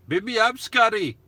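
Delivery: high, loud mock-Irish call; Irish accent. Shown as a demonstration. Vlogger showing off an accent for viewers, mock Irish. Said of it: "I can do an Irish accent".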